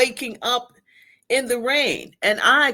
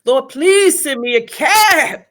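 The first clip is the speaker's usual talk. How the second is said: loud, slow cry to God; a loud, slow, higher prayer for rescue; desperate prayer; 7 semitones higher, 9 dB louder than usual. Retold or imitated. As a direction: plead with the Lord at full voice, slowly. Calling on God in distress.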